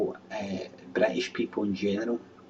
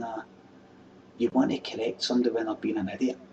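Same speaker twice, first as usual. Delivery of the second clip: ordinary talk, nothing marked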